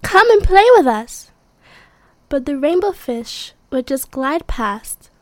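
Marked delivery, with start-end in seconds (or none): 0.0-1.3 high, loud friendly call. Acted. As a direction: shout it out to a friend. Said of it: "they would call".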